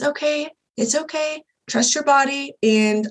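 calm her down gently over the phone; soothing midwife. Retold or imitated.

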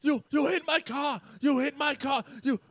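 high-pitched, sputtering accusation; mock outraged driver. Driver accusing them after the tap, high mock outrage. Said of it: "he starts".

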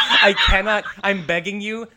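high, loud burst of laughter into words; shrieking laugh. Real, not acted. Podcaster cracking up at the crispy piggy line, shrieking laugh. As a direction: crack up at your own impression.

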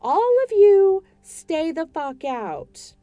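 loud, high furious shout; woman shouting at ghosts, slowing at the end. Acted. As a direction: order them all out at full volume. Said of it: "A D Bryant shouted".

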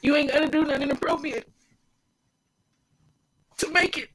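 choking up mid-sentence as tears come; crying. Real, not acted. Urging listeners to trust God, breaking into real tears. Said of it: "you won't understand why I'm crying".

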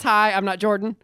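usual speaking voice

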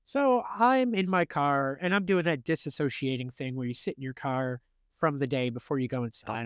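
usual speaking voice

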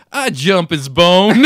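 loud, drawn-out American drawl; American accent. Retold or imitated. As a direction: imitate her American accent, high and loud. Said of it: "such an American accent".

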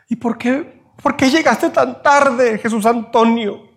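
a sweetheart asking why he came late; hurt girlfriend. Acted. Girlfriend reproaches in film scene.

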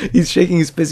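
amused. Real, not acted. Laughing at the fist-shaking husband, amused. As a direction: say it again, cracking up at the scene.

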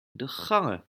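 plain reading voice

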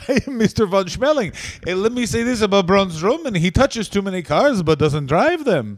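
hammed-up German accent; mock German accent. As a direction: sneer as a cartoon German heel. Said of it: "both good at accents".

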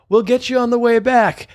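fake friendly promise. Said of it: "And I went".

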